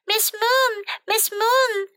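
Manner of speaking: high-pitched pupil hailing the teacher; eager calling out. A narrator's reading. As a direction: call for the teacher's attention eagerly. Eager pupil calls his teacher.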